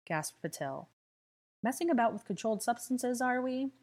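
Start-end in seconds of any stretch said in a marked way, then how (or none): 1.6-3.7 teasing. A narrator's reading. Draco teases Theo.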